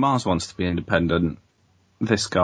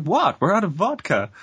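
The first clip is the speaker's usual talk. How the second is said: innocent mother; 9 semitones higher than usual. Retold or imitated. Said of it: "she's like".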